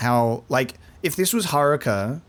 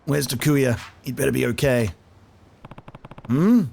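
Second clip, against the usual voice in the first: ordinary talk, nothing marked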